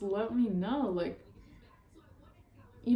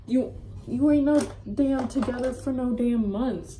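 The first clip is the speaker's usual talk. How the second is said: mock scolding; 2 semitones higher, 6 dB louder than usual. Scolding the other girl.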